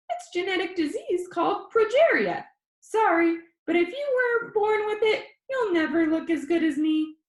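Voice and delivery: high, squeaky, cocky boast; high squeaky boy. Acted. Danny jokes to his viewers in a high squeaky voice. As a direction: brag like a cheeky kid showing off. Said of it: "His voice was high and squeaky".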